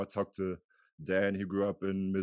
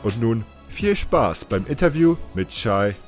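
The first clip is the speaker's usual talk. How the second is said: louder, lively German host welcome; podcast intro; 4 semitones higher, 10 dB louder than usual. Acted. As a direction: read the show intro with projected, lifted energy. Host's scripted podcast intro in German.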